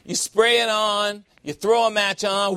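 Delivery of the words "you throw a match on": ordinary talk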